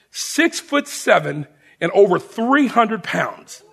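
ordinary talk

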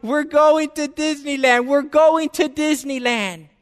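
high, loud, tearful shout of joy; child's joyful cry. Retold or imitated. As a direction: cry it out like a little girl overjoyed. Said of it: "She's crying".

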